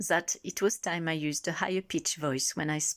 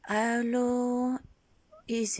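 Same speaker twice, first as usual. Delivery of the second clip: higher test tone; 5 semitones higher, much slower than usual. Shown as a demonstration. Testing her voice pitch.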